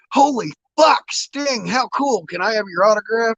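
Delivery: high-pitched mock scream of a starstruck fan; gushing fanboy squeal, hammed-up; retold fan screaming. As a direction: imitate an excited valet, voice shot way up high. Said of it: "started screaming for my autograph".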